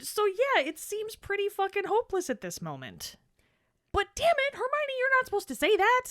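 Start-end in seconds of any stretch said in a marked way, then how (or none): 3.9-6.0 high, loud exasperation; exasperated, high and loud. Real, not acted. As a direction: scold her in a raised pitch. Scolding Hermione, exasperated.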